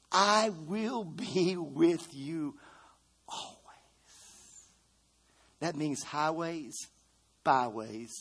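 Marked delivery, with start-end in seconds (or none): 0.1-3.5 very slow deliberate quote. Acted. Slowed quote.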